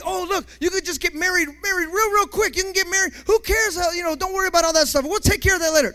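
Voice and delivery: the enemy. Acted. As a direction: play the tempter rushing them into it. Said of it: "Here comes the enemy".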